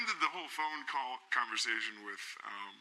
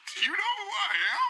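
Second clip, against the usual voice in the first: laughing; 5 dB louder than usual. Retold or imitated. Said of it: "I was like".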